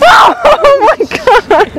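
shriek. Real, not acted. Scared, shrieking at something.

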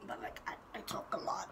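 unvoiced confiding whisper; whispered aside. Vlogger admitting she talks a lot, whispered aside. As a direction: whisper it like a secret.

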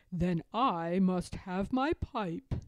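slow, lightly voiced grandfather; a hint of an old grandpa stalling; playful grandfather. A narrator's reading.

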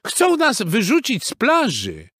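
high, loud complaint; clucking hen voice. A narrator's reading. Hens complaining to the horse, clucking. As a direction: tattle like an indignant hen. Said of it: "zagdakały kury".

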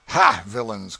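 loud, villainous count; imperious shout. Acted. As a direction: burst out like a tyrant lord. Count hails players roughly.

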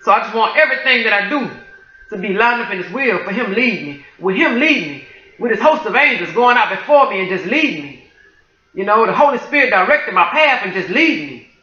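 normal talking voice

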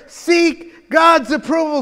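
"Seek God's approval" drawn out slowly, each word weighted; preacher quoting the apostle's charge to Timothy, slow and solemn; slow solemn command. Retold or imitated. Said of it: "he's telling them here".

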